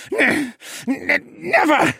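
high, loud, stammering snarl through pain; strained snarl. Acted. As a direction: force the word out against the spell. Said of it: "the Elvenborn snarled".

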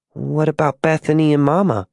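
lightly voiced girl, concerned; worried little girl. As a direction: ask as an anxious small child.

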